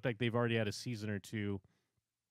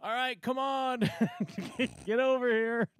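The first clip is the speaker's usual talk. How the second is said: much higher, strained mock brave call with a laugh; forced composure; 11 semitones higher than usual. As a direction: pull yourself together and call him over. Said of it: "compose themselves and be like".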